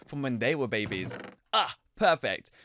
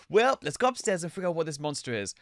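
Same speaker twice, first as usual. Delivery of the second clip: normal talking voice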